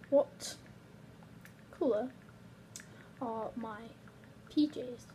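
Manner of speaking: word by word with long gaps; typing aloud. Real, not acted. YouTuber typing a reply to the clown number, slow and halting. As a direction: say each word slowly as you type it. Saying words while typing a text.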